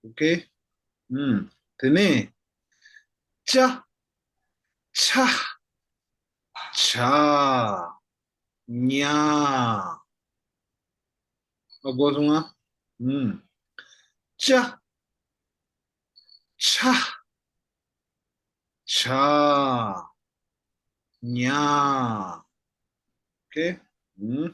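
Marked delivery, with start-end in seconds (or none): 3.5-10.0 Cha Cha Cha then Nya, long and drawn out; slow drill of Cha then Nya; syllable drill. Shown as a demonstration. Teacher to class, drilling each sound slowly. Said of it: "I will read them one by one". 14.4-22.4 Cha Cha Cha then Nya, long and drawn out; slow drill of Cha then Nya; syllable drill. Shown as a demonstration. Teacher to class, drilling each sound slowly. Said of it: "I will read them one by one".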